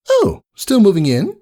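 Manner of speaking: high, elegant and polite; gracious welcome; refined lady unicorn. Acted. Rarity greeting Rainbow Dash at her door, refined politeness.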